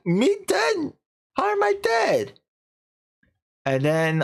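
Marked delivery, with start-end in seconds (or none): 0.1-2.4 very high, incredulous protest; imitated astonished woman; mock disbelief. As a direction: act baffled in a squeaky high voice. Revived woman denies being dead.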